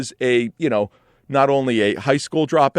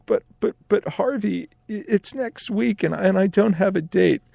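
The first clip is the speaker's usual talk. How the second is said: high, stammering mock plea; put-on sad little voice; pleading, teary; 8 semitones higher than usual. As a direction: play the pitiful kid begging. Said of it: "with the saddest, teariest eyes that I could muster".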